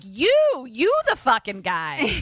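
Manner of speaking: loud, high mock indignation breaking into laughter; indignant retort. Real, not acted. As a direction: throw it back at them, amused. Throws character's line back, laughing.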